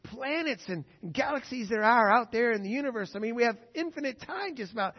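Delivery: lightly voiced smug atheist; mock skeptic. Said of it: "They say".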